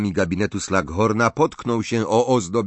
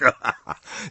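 put-on hearty old man's chuckle; a warm throaty chuckle; 2 semitones higher than usual. A narrator's reading. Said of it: "Zachichotał". (rubaszny chichot)

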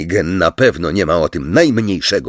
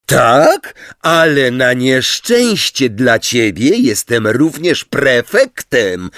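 higher, emphatic, last word drawn out; heated, forceful comeback; roared retort; 5 semitones higher, 3 dB louder, slower than usual. A narrator's reading. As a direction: pull rank in anger. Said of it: "ryknął Ron".

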